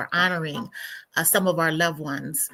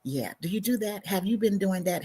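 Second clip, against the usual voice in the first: ordinary talk, nothing marked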